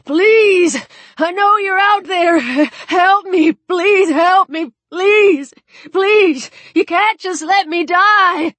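high, loud desperate begging; desperate pleading. Acted. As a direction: shout for help while drowning. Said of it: "his voice reedy with shock".

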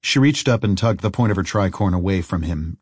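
usual speaking voice

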